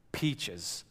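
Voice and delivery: mock-dreamy wish for Peaches; dreamy longing. Wishing aloud to his wife.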